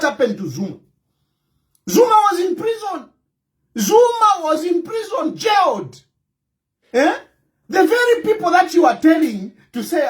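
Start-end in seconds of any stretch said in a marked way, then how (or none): 1.9-8.9 much higher, shouted outrage; indignant shout. Real, not acted. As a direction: hammer home the injustice. Ranting about Zuma's jailing.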